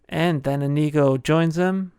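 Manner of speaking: ordinary talk, nothing marked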